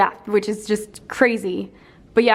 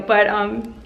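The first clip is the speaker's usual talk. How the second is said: ordinary talk, nothing marked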